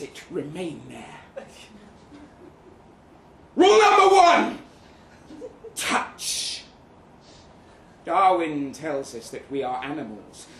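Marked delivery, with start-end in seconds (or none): 3.6-4.6 much higher and louder, proclaiming; grand announcement. Acted. Narrator announces seduction rule. 5.7-6.6 whispered, secretive; hushed whisper. Acted. Rule revealed in hushed voice.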